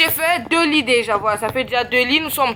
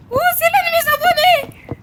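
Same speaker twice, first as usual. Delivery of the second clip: leaping very high; delighted surprise; 3 dB louder than usual. Real, not acted. Streamer spotting another subscriber, high and delighted. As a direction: gasp of joy.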